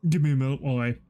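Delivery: talking through a yawn; words stretched by a big yawn. Real, not acted. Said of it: "when I yawned".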